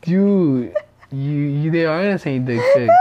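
fast, laughing through words. Real, not acted. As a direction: laugh while blurting it out.

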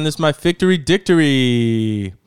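loud, hammed-up segment host; playful show-opening announcement; announcer intro. Opens his own named segment.

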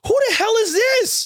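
high, amazed outburst; mock astonished squeal. Reacting to Roman spearing Bray Wyatt, high mock astonishment. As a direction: shoot up in pretend shock. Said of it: "I was like".

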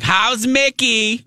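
high, slow squeaky Brooklyn mom asking; screechy Marianne. Retold or imitated. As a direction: drag it out in a piping fan voice. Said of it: "a really screechy voice".

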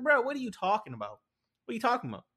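mock-baffled pushback at a friend's bad take; incredulous. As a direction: ask it like you can't believe what you heard. Friend's claim answered in disbelief.

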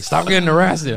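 loud, high retold reprimand; mock scolding. Trader Joe's coworkers to sore-wristed employee, mock scolding.

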